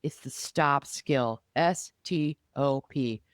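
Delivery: usual speaking voice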